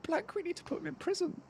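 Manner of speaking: high, softened mock outrage; stunned half-whisper; hushed disbelief. Real, not acted. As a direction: say it under your breath, astonished. Co-caster reacting in mock outrage.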